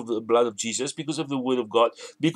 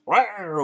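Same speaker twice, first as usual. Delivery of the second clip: higher-pitched mock lion roar; 4 semitones higher than usual. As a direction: give a short pretend roar. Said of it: "he goes to the elephant".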